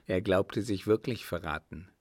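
usual speaking voice

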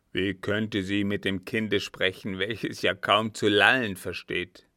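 put-on loud, gruff old captain; irate, incredulous question; indignant disbelief. A narrator's reading. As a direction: argue back with mounting force. Disbelieving captain argues with servant.

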